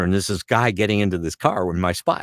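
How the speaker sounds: ordinary delivery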